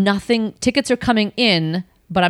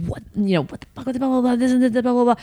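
higher, mock angry rant; retold yelling; 5 semitones higher, faster than usual. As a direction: rattle off a hammed-up tirade, high-pitched. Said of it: "I yelled".

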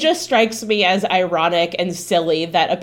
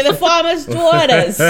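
put-on higher, louder, laughing rural Southern accent; second try at accent; 8 semitones higher, 5 dB louder than usual. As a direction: try the country accent again through laughter. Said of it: "Keep trying".

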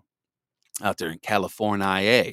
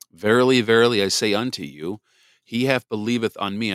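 scripture reading; 6 dB louder than usual. Acted. Reads scripture aloud.

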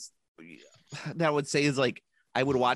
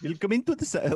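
high mock German "Willkommen to the"; German host voice, raised; German accent; 9 semitones higher than usual. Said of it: "it's Willkommen".

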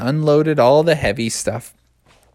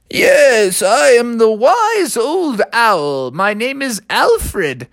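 put-on wise old owl; 9 semitones higher, much slower than usual. Old owl introduces himself.

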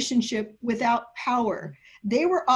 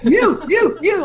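laughing, louder repeated YOU jabs; pointed foul call; 6 semitones higher, 9 dB louder than usual. Shown as a demonstration. Teacher calling out the men in a Greek text, punching You. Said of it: "like we used to say when someone did a foul in basketball".

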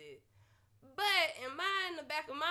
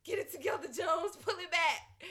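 scolding herself; 6 semitones higher, 5 dB louder than usual. Retold or imitated. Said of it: "I was like".

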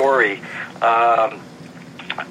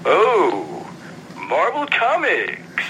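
unimpressed Takei; 3 semitones higher, much slower than usual. Retold or imitated. Takei's reply, retold.

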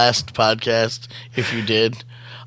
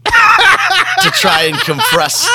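laughing; 5 dB louder, much slower than usual. Real, not acted. Laughing through injury story.

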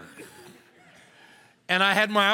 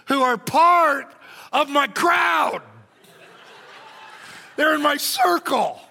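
excited; 6 semitones higher, 3 dB louder, slower than usual. Retold or imitated. Laughing emphatic retelling.

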